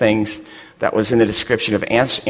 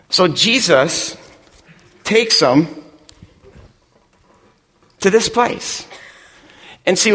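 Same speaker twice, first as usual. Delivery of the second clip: preaching; 5 semitones higher than usual. Acted. Pastor driving the point home to his congregation, preaching. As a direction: preach it to the room with growing force. Preacher builds to full force.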